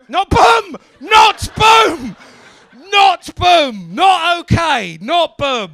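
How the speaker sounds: loud, high exasperated yelling; hammed-up outraged shouting; mock scolding. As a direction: shout each protest like a comic telling-off. Mock scolding of John's boom.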